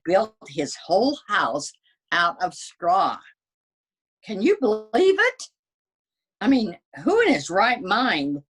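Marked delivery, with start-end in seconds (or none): 4.2-8.4 high, louder mocking disbelief; incredulous scorn. Wolf scoffing to readers about the pig, incredulous. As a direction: scoff at the pig's foolishness.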